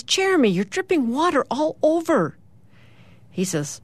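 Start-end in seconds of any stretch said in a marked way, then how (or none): 0.0-2.4 high, exasperated mock-mom complaint; scolding mom. A narrator's reading. Mom comes upon Jeremy standing in a puddle, acted scolding. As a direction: scold a messy son, pitched well up.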